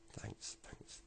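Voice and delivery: breathy, very soft thanks; faint. Retold or imitated. Granddad thanking the officer, breathless and faint. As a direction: barely get the words out.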